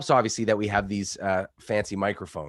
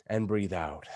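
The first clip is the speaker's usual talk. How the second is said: soft breathing cue; 3 semitones lower, 5 dB quieter than usual. Shown as a demonstration. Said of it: "quick mindfulness meditation".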